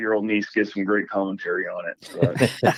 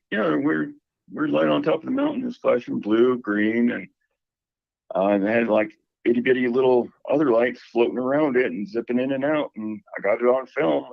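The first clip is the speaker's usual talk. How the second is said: higher-pitched imitation of his sister, casual; imitating sister; 4 semitones higher than usual. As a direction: quote the sister in a lifted, higher voice. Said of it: "She's like".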